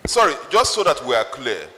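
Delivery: usual speaking voice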